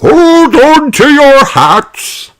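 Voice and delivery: loud, high, slow shout; hammed-up high rabbit yell. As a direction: bellow it over the engine. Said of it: "shouts Grampy Rabbit".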